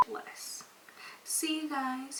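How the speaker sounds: soft whispered goodbye. Real, not acted. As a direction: breathe it out, unvoiced. Whispered sign-off.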